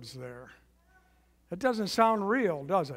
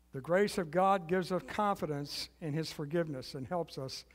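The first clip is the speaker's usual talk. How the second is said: normal talking voice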